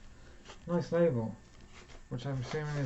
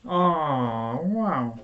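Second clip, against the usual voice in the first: surprised wow; 7 dB louder than usual. Real, not acted. Unwrapping the record, impressed.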